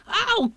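high squealed ow; cried "ow". A narrator's reading. Piglet being scrubbed in the bath, squealed ow. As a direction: yelp in a tiny high voice. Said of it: "cried Piglet".

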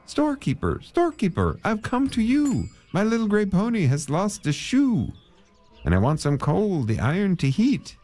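high, singsong pleading call; lightly sung plea for coal. Acted. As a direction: beg the storekeeper in a high voice. Man pleads to storekeeper.

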